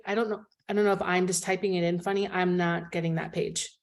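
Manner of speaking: ordinary talk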